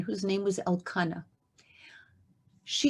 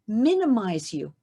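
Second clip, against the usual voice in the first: usual speaking voice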